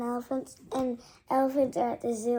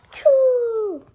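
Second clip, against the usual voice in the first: big fake sneeze; 9 semitones higher, 12 dB louder than usual. Gerald sneezes again.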